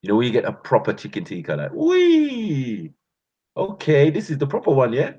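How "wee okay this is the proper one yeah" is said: excited about food. Retold or imitated. Excited about proper chicken tikka.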